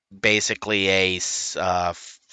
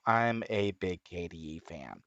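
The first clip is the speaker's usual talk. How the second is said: ordinary delivery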